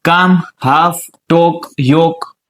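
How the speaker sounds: કામ, હાફ, ટોક, યોક slowly, without any l; silent L. Shown as a demonstration. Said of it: "એલ silent હોય". (કામ, હાફ, ટોક, યોકમાં 'લ' વગર, ધીમેથી)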